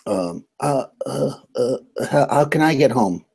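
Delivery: hesitant, stammered question; halting start, pitch lifting into the question; Robert. Acted. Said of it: "so Robert says".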